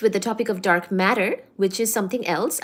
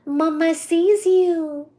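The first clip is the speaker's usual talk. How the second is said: slow, higher, louder coo at the cat; 7 semitones higher, 4 dB louder, much slower than usual. Real, not acted. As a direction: stretch Mama sees you out for the cat. Cooing at the cat.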